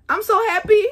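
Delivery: high, loud and joyful. Real, not acted. Pregnant mom rejoices to viewers, high and bright. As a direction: burst out with joy. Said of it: "happy pregnant mom".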